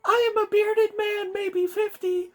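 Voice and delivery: very high strained man voice; squeaky damaged voice. Acted. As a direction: squeeze it out very high. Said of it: "his larynx was damaged recently".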